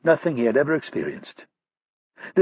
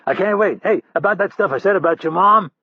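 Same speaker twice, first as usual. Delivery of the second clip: higher, louder sarcastic goading; mock eager taunt; 8 semitones higher, 4 dB louder than usual. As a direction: needle the captor with fake glee. Hurley keeps taunting Roddy.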